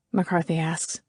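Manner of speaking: ordinary delivery, nothing marked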